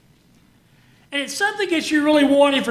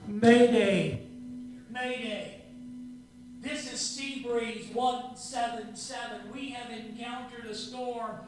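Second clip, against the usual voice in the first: faint distress call over static; quiet, distant radio voice; mayday radio call; 12 dB quieter than usual. Pretend. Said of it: "you're able to hear the words".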